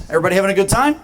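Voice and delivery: loud, high crowd hype; hyping the crowd. Real, not acted. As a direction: pump up the audience.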